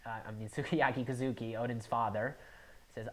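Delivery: ordinary talk, nothing marked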